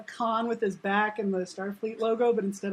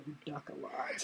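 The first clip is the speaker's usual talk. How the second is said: much softer dry low aside; deadpan mutter; 6 semitones lower, 16 dB quieter than usual. Retold or imitated. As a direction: drop it under your breath.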